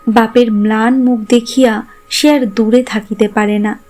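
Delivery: usual speaking voice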